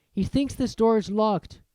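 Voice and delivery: ordinary delivery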